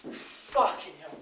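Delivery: slow, breathless whisper, low; whispered despair. Acted. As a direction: let it fall out under your breath. Repeats the curse to himself.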